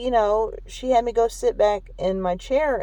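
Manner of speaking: usual speaking voice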